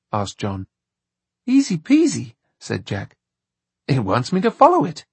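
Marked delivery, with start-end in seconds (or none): 1.5-2.3 put-on child voice. A narrator's reading. Voicing Jack. 3.9-5.1 put-on high, fast boy voice; matter-of-fact little boy; child voice. A narrator's reading. Voicing Jack.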